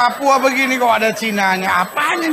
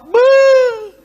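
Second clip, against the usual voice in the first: mock groan; 12 semitones higher, 7 dB louder than usual. In-law visit reaction.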